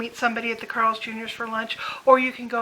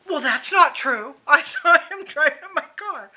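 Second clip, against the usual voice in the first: higher, louder mock old-lady insistence with a laugh; stubborn mother, laughing; 4 semitones higher, 6 dB louder than usual.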